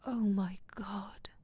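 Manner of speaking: slow, breathy, hushed realization; awed inner thought. A narrator's reading. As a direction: think it aloud under your breath. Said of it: "he thought".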